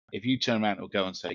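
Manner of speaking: ordinary delivery, nothing marked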